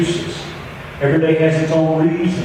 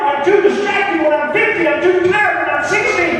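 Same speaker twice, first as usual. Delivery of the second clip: high, rapid-rolling list of excuses; excuse-making; 9 semitones higher than usual. Retold or imitated. Preacher voicing Felix's lifelong excuses to the pastor, high and insistent. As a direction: preach the excuses high, piling one on another. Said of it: "Somehow Felix said".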